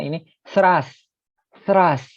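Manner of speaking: "trust" with the tongue lightly between the teeth; the t of "trust" bitten, almost th; tongue bitten slightly. Shown as a demonstration. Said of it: "lidahnya agak digigit".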